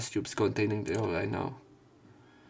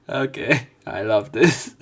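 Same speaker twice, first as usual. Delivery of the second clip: loud delighted; 8 dB louder, slower than usual. Real, not acted. Delighted by the twist.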